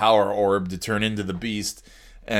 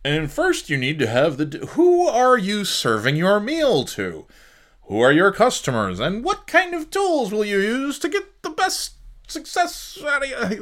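mocking corporate presenter; 10 semitones higher than usual. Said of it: "we present".

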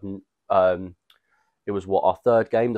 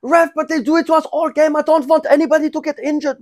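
very high, loud pleading whine; hammed-up squeaky complaint to the ref; mock whiny protest; 8 dB louder than usual. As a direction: whinge at the ref like a sore loser. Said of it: "he was like".

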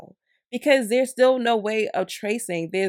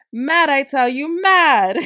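mock Nikki, drawn-out and higher; 6 semitones higher, 8 dB louder, slower than usual. To viewers about the scam, a lightly voiced Nikki. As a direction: ham up a Nikki-style outrage. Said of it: "in my Nikki voice".

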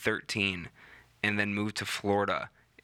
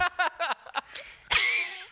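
smoker's rasp laugh. Shown as a demonstration. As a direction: push every bit of breath out in a rasping laugh. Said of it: "I got a good Marc Maron impression".

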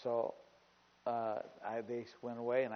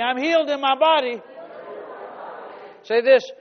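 high, loud preacher's cry; raised voice, urging everyone to declare it; full-force preaching; 9 semitones higher, 14 dB louder than usual. Real, not acted. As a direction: drive it out boldly to the congregation.

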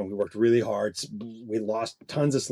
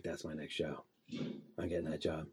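low, much softer murmur to himself; a whispered-sounding private promise, lower and far softer; hushed vow; 6 semitones lower, 13 dB quieter than usual. Retold or imitated. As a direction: say it low and quiet, half to yourself. Quiet awed inner vow after seeing show.

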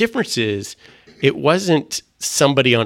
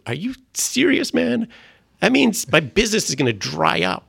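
much higher, stunned "are you serious, man"; disbelief; 9 semitones higher than usual. Retold or imitated. Man answering God's command, in high-pitched disbelief. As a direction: react like you can't believe what you're hearing. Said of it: "And I was like".

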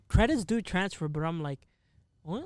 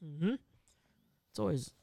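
usual speaking voice

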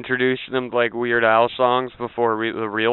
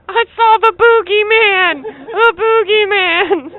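put-on much higher, slow frightened child voice; scared kid; 4 dB louder, slower than usual. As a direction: play a spooked little kid waking up. Kid woken by imagined boogeyman.

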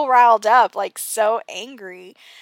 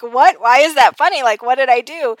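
louder mock-baffled protest; baffled husband; 3 semitones higher, 8 dB louder than usual. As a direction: imitate a husband's loud, puzzled questioning. Retelling husband's baffled reaction.

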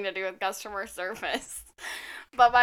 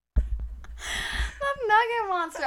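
high, slow, drawn-out awe; awed drawn-out; 11 semitones higher, much slower than usual. Real, not acted. Savoring the nickname Nugget Monster, high and slow in awe.

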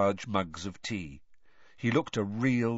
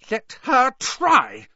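very high, loud and slow; shrill fury, dragged out; 13 semitones higher, 9 dB louder, much slower than usual. A narrator's reading. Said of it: "said Hermione shrilly".

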